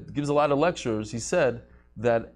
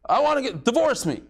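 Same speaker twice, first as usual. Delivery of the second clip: higher, pleading mock demand from a wife; upset wife demanding divorce; 7 semitones higher than usual. Teacher voicing an upset wife who demands a divorce, high mock plea. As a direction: play the fed-up wife crying out for a divorce.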